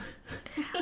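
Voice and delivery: a high, airy burst of laughter; breathy laugh. Real, not acted. Laugh after the quote.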